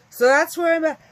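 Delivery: said through a big yawn. Real, not acted. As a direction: stretch the words mid-yawn, high and loud. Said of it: "I'm always yawning".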